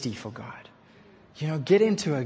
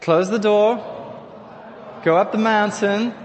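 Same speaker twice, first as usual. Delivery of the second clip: call-out phrase; 3 semitones higher, 5 dB louder than usual. Shown as a demonstration. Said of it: "Tell the person next to you".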